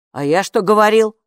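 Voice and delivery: plain reading voice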